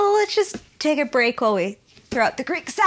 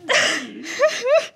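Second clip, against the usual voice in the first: sobbing laugh; 3 semitones higher than usual. Acted. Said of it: "please don't cry".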